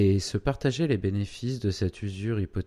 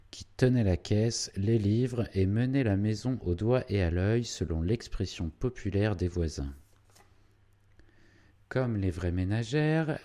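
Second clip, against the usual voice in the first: ordinary delivery, nothing marked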